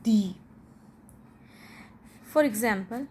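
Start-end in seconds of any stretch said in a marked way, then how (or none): 0.0-0.3 "the" said as a long "thee"; emphatic long "the". Shown as a demonstration. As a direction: lean on "the" with an ee vowel. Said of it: "it is also called".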